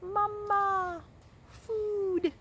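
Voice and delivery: very high tiny peep; peeping gosling. A narrator's reading. Gosling peeping at the robot for food. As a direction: peep it like a hungry chick. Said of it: "said the gosling".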